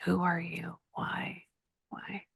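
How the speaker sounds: soft unvoiced questions to the spirit; whisper. Real, not acted. Psychic questioning a spirit mid-reading, whispered. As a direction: breathe the questions to someone unseen.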